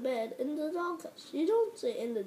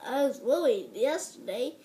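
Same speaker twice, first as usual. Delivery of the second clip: ordinary talk, nothing marked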